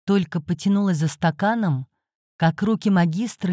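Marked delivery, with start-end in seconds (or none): none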